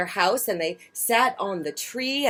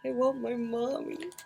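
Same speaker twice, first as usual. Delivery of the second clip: higher, soft whimpery voice; littlest owlet; 6 semitones higher, 7 dB quieter than usual. A narrator's reading. Lightly voiced baby owl Bill wanting his mother, soft. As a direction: plead like the tiny one. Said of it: "said Bill".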